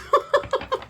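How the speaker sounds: high, breathy burst of laughter; exasperated laugh. Real, not acted. Reviewer laughs in disbelief at the sloppy photos, a high burst.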